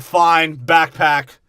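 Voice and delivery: mock Caesar shout. Caesar voiced in movie recap.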